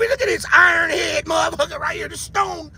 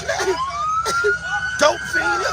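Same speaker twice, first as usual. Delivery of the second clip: much higher squeak breaking into a laugh; mocking falsetto laugh; 3 dB quieter than usual. Mocking the people in the video.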